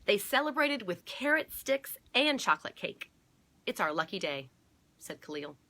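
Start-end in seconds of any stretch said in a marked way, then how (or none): 0.0-4.5 loud, lively read-aloud; animated picture-book reading; story narration. A narrator's reading. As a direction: read out the happy ending with bounce.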